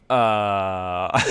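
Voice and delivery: whacked out. Acted. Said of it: "totally whacked out".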